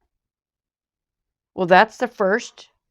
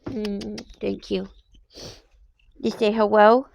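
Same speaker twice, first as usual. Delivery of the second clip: usual speaking voice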